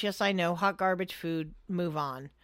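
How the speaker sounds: usual speaking voice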